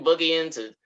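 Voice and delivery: ordinary talk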